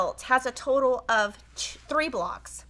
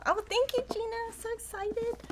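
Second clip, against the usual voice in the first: excited thanks; 11 semitones higher, 6 dB quieter than usual. Real, not acted. Thanking Gina again.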